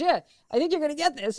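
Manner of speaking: high, loud insistence, imitated; retold emphatic verdict. Casting woman repeats verdict more firmly.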